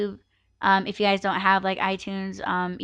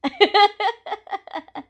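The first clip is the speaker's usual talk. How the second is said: high laugh; 12 semitones higher than usual. Real, not acted. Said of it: "laughing after fake cry".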